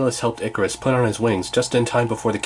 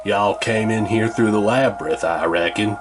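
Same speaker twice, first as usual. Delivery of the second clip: put-on southern accent; 2 semitones lower than usual. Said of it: "in a southern accent".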